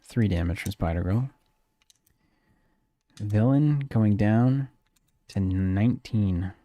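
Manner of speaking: measured play-by-play, slowing down on the last call; turn narration. Pretend. Player narrating friend's turn, light commentator delivery. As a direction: narrate the card game like a mild commentator. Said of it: "I'm gonna narrate this turn".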